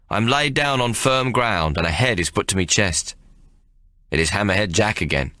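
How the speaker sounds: usual speaking voice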